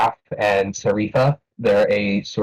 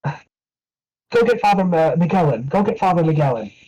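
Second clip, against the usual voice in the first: high, urgent call to her daughter; 8 semitones higher than usual. Acted. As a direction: send the girl running for the priest. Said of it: "she shouts to Mina".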